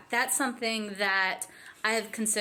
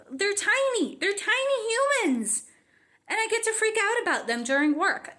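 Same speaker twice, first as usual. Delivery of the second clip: gushing delight; 9 semitones higher than usual. Real, not acted. Gushing about kids at work, excited and high. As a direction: coo about tiny humans.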